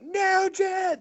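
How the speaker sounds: very high, drawn-out mock cheer; crowd going crazy. Everyone cheering [name]'s shooting, going crazy. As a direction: imitate a frenzied crowd yelling a name. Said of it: "They're going crazy".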